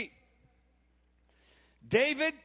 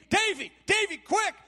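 excited calling; 5 semitones higher than usual. Acted. Big brothers running to fetch David, excited calling. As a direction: shout for your little brother to hurry.

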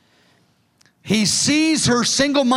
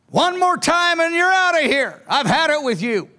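high, fed-up threat; harsh scolding tone; 7 semitones higher than usual. Retold or imitated. Preacher mimics an angry God's threatening voice, high and exasperated. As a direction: warn them it's the last chance. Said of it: "That's not the voice of our beloved".

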